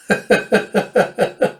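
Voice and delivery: high, rapid run of giggles; real giggle. Real, not acted. Reader amused by Amir's oblivious question, giggling. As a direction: burst into a sustained, high chuckle.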